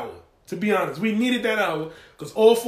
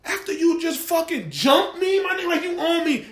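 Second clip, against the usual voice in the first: outraged protest; 7 semitones higher than usual. Retold or imitated. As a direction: drag out the complaint in mock outrage. Acted father outraged at police.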